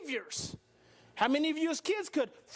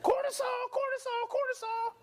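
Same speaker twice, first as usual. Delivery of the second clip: high, quick repeated alarm call; 9 semitones higher than usual. Acted. Brain flooding at a threat, high urgent alarm. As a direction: sound the alarm on one high pitch.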